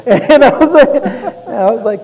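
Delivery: laughing hard, voice way up. Real, not acted. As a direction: crack up mid-sentence. Laughing while telling story.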